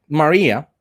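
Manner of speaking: normal talking voice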